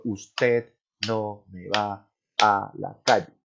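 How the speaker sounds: slow, each word of "Usted no me va a la calle" hit separately like a stern order; scolding mother, every word stressed. Shown as a demonstration. Mother scolding her son, stressing each word slowly. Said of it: "le hace el acento a cada palabra".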